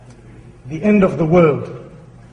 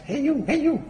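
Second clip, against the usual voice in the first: high, softer parrot call; talking parrot; 8 semitones higher, 6 dB quieter than usual. Acted. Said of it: "the same thing happens".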